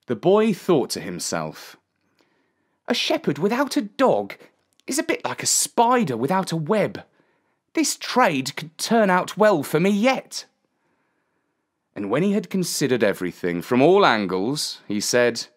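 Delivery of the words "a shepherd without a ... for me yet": put-on high-pitched boy's voice; pondering shepherd boy. A narrator's reading. Boy weighs up the trade to himself, high musing voice. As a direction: think it through as a young lad.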